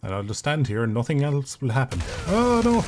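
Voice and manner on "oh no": high, loud "oh no" outburst; alarmed cry. Real, not acted. Sudden mishap in the game.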